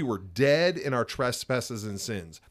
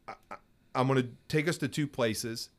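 ordinary talk, nothing marked

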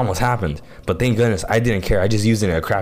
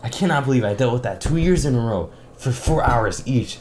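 ordinary delivery

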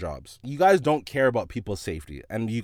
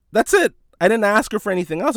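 loud, high-pitched, quick protest; emphatic self-defence; 10 semitones higher, 7 dB louder than usual. Retold or imitated. Clerk defending himself to shopper's friend, emphatic. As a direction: protest your innocence loudly and fast.

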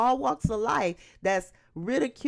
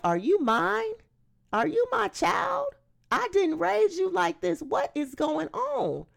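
higher-pitched, incredulous questioning; exasperated parent; 9 semitones higher than usual. Acted. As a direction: demand answers like a baffled, exasperated parent. Parent voiced scolding child.

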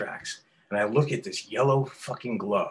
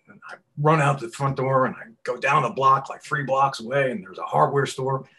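normal talking voice

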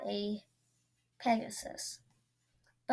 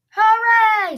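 high, very loud cheer; joyful cheer; 11 semitones higher, 15 dB louder than usual. Acted. Goat boy cheering the monster's retreat, loud cheer. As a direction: cheer with all your heart. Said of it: "said Duff".

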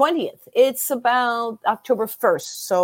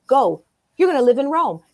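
louder, higher pushing voice; urgent command; 5 semitones higher, 5 dB louder than usual. Retold or imitated. Psychic ordering her friend out, loud and urgent. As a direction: shoo her out the door. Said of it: "she literally pushed me out the door".

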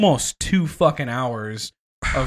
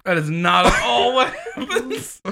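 laughing protest; 9 semitones higher than usual. Real, not acted.

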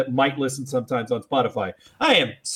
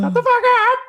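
squawking parrot; 4 dB louder, faster than usual. Pretend. Mock parrot moderator.

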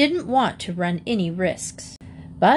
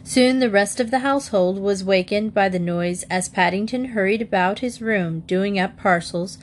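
ordinary delivery, nothing marked

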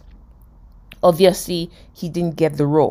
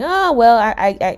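blustering; 4 semitones higher, 5 dB louder than usual. Acted. Joey talking himself into the audition, blustering. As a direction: brush it off, tripping over the first word. Joey's self-deluded bluster, acted.

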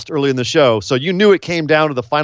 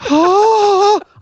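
gasp; 3 dB louder than usual. Real, not acted. Sharp gasp at a reveal.

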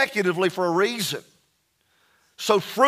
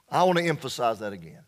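ordinary talk

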